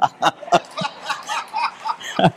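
a short high laugh; happy burst of laughter; delighted laugh. Real, not acted. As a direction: laugh out at the story's end. Laughs at the punchline.

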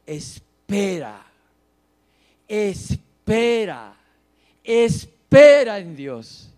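slow, building to a loud cry; emphatic self-exhortation. Acted. Psalmist preaching to his own soul, building emphatically. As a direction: draw out each "Espera", the last one booming.

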